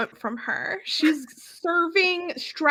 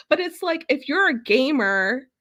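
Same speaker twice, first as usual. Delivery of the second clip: usual speaking voice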